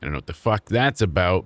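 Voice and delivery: normal talking voice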